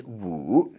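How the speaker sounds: "wu" dipping down and then up; third tone. Shown as a demonstration. Teacher showing learners number five, dipping third tone. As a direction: stretch "wu" through a low dip and rise. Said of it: "that goes down and then up".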